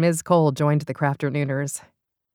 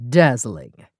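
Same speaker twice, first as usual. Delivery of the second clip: agreeing; 4 semitones lower, 5 dB louder than usual. Acted. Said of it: "Violet agreed".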